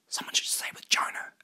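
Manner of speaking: hushed conspiratorial whisper; soft, voiceless. Acted. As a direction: whisper the suggestion softly. Secret argument in the boat.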